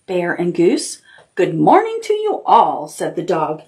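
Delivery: gentle storybook reading; reading to children. Acted. Teacher reading a picture book to the class, storytelling. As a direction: tell it like bedtime story time.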